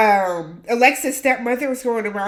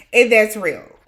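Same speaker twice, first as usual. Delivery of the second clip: usual speaking voice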